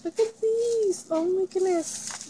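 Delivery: excited. Real, not acted. Unboxing gifts, squealing with delight.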